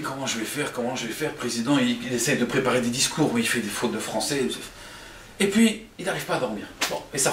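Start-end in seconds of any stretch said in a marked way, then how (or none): 0.0-1.3 low, soft fretting; stressed muttering. Acted. Man stressing all night, quiet worried muttering. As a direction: repeat it anxiously under your breath. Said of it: "il se stresse toute la nuit".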